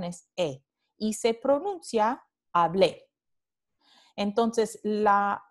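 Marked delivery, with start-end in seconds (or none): 2.5-3.1 hablé with the stress on the final -blé; stress on final syllable. Shown as a demonstration. Teacher showing students a regular preterite, hablé stressed on the ending. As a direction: lean on the last syllable of hablé. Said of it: "La vocal tónica está en la terminación".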